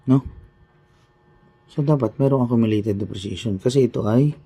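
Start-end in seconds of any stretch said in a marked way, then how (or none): none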